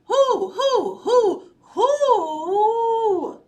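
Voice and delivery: high, loud run of hoots; frantic storybook owl call. A narrator's reading. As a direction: hoot urgently, stretching the last one. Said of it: "Owl called".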